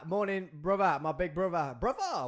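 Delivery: put-on a light London geezer accent; London gangster. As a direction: greet him like a London gangster film. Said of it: "I sound like I was in Lock, Stock".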